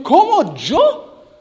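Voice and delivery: high, drawn-out "¿Cómo yo?" in disbelief; incredulous protest. Retold or imitated. Shy youth protesting to the Lord, disbelieving. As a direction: stretch it out, stunned to be chosen. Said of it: "como diciendo al Señor".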